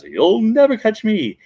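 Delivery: high, loud, drawn-out taunt; Weevil Knievel taunting. Retold or imitated. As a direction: crow it high and slow. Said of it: "Weevil Knievel says".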